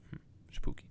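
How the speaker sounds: whispered mock spooky aside. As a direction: whisper it like a ghost story.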